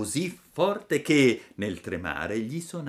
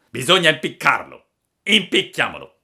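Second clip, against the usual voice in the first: loud, raised villain voice; first assassin's verdict; 5 semitones higher, 10 dB louder than usual. A narrator's reading. Assassin proposes hanging the puppet, loud villain verdict. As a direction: pronounce the sentence forcefully.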